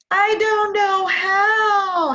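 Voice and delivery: high, slow, loud mock sob; hammed-up crying whine. Mocking people's excuses.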